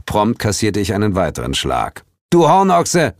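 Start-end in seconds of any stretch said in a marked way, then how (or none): 2.3-3.1 high, loud scolding exclamation; raised-voice exasperation; indignant call. A narrator's reading. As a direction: shout the insult at a dim friend. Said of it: "rief Suko".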